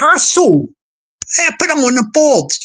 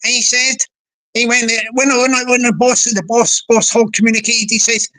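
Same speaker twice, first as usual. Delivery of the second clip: ordinary delivery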